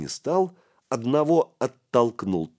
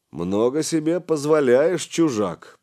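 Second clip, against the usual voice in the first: loud, displeased grumble; disgruntled boss; grumbling; 7 semitones higher, 5 dB louder than usual. A narrator's reading. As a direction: rebuke the stranger grumpily. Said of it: "проворчал Гораст".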